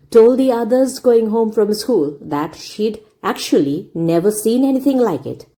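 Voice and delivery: ordinary delivery, nothing marked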